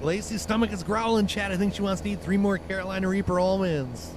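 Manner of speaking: ordinary talk, nothing marked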